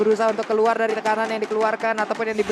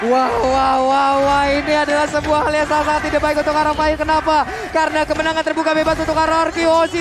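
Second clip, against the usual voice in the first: loud, higher hype call; hyped shouting; 4 semitones higher, 3 dB louder than usual. Real, not acted. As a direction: shout the win like an announcer. Caster calling the game win.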